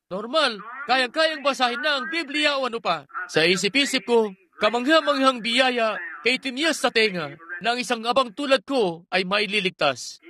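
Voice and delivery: ordinary talk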